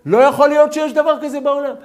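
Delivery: high, loud preaching; fiery preaching. Acted. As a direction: preach it out to the class with full force. Teacher preaching at full force. (דרשה בלהט, גבוה וחזק)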